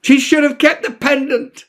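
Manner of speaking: high, raw yell at the sky; furious yell. Acted. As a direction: hurl it up at the sun in fury. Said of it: "yelling at them with a hurting heart".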